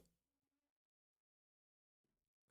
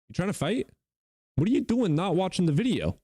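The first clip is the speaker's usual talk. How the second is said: mock outrage; 10 semitones higher than usual. Mock scolding viewers.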